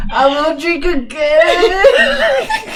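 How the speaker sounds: very slow, high, wailing cry; crying promise. Retold or imitated. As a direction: wail it like a remorseful drunk. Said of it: "I'm all getting teary-eyed".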